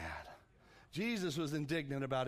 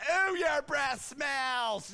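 put-on slow, loud, much higher child's shout; blunt kid; 9 semitones higher, 7 dB louder, much slower than usual. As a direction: blurt it like a tactless kid. Kids telling workers off.

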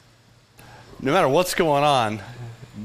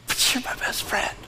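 whisper; 3 semitones higher, 7 dB quieter than usual. Retold or imitated. John voiced meeting his friend.

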